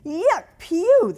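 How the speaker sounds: high, loud squeal of disgust; girls' disgusted scream. Acted. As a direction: squeal at the stink like little girls. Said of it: "the two younger girls screamed".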